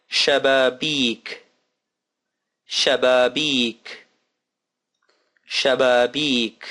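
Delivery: "Shababik" said slowly and evenly; slow model word. Shown as a demonstration. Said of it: "model word repeated slowly".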